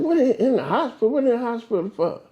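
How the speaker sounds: quick, high shocked disbelief; jolted by the news, fire off the questions; hearing his brother is in hospital, shocked. Retold or imitated.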